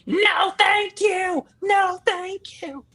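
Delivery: high-pitched, drawn-out mock scream; screaming kid. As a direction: imitate a furious child screaming a polite refusal. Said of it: "in your face screaming at you".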